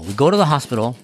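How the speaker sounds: ordinary talk, nothing marked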